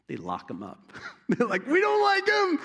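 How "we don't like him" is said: very high, loud crowd yell; mock angry shout. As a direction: yell it like a petulant mob. Acts out the crowd rejecting Micaiah.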